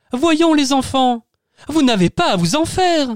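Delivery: high, slow soothing parent voice; calm the kids down gently; reassuring parent. Acted. Parents reassuring the children, slow high soothing voice. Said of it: "maman et papa ... s'exclamèrent".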